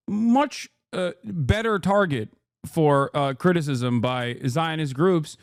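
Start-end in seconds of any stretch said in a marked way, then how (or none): none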